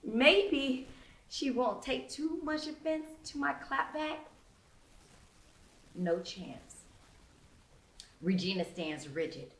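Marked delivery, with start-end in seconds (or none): none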